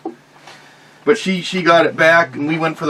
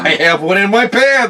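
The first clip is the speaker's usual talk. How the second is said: higher, put-on eager blurt; mock boast; 6 semitones higher, 4 dB louder than usual. Co-host mimics friend on a date, mock boastful. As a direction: blurt it as a mocking imitation.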